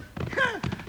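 a short high chuckle, softly; a quick amused laugh. Acted. Jingles chuckling to Bill about the nice old geezer, amused laugh.

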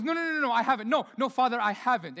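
high, loud pleading; mock frantic Simba; desperate denial. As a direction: beg your father to believe you.

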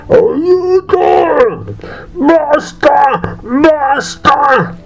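high, pleading brigands crying out to stop; exhausted brigands begging the drummer to stop; desperate cries. A narrator's reading. Brigands forced to dance.

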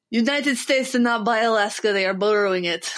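imitated Ukrainian student with Russian accent. Exchange student correcting Americans about Alaska, Russian accent. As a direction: put on a Russian accent, stating it as fact. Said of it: "accents to do is the Russian accent".

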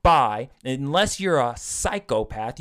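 ordinary talk, nothing marked

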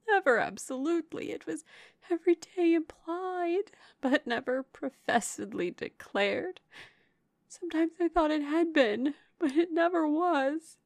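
high, halting, plaintive voice; distressed confession. A narrator's reading. Heartbroken sister admitting love was never declared, distressed. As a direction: falter between answers, voice high.

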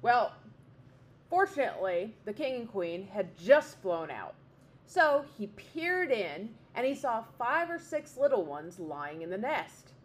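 ordinary delivery, nothing marked

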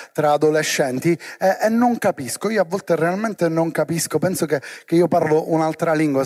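normal talking voice

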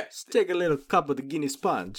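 put-on Jamaican lilt; offering a drink in mock Jamaican; bad Jamaican accent. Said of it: "bad Jamaican accent".

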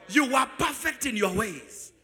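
slow, loud, proclaiming the verse; loud scripture reading. Acted. Preacher leading the church in reading the verse, loud and slow. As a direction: read it out to the whole hall, slowly. Congregation reads scripture aloud.

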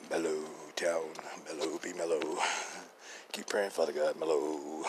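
low, soft mock-soothing chant; playful hushed prayer. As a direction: hush it right down, stretching "mellow". Mock calming prayer for the town.

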